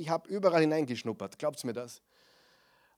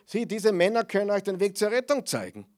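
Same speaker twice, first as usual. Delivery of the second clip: higher, louder mock call of the possessed woman; calls it out again and again, raised; retold shout; 6 semitones higher, 5 dB louder than usual. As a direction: slave woman pestering Paulus, half-acted loud cry. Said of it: "und ständig sagt".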